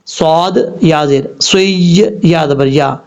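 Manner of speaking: ordinary talk, nothing marked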